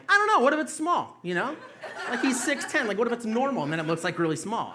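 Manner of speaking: high, loud mock-serious pondering; mock earnest musing. Comic answers dad's question, high mock-earnest voice. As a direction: play it dead serious and curious. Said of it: "of course, I'm like".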